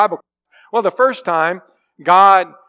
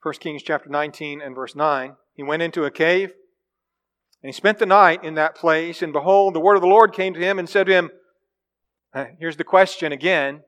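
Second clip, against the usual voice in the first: ordinary delivery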